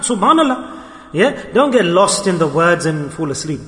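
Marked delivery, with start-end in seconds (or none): 0.0-0.8 raised pitch without extra volume; half-hearted demonstration of crying out; mild mock scream. Shown as a demonstration. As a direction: urge the crowd with a higher-pitched call. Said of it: "then scream out".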